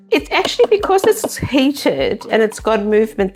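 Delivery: normal talking voice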